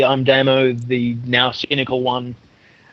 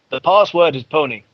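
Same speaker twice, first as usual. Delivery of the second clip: ordinary delivery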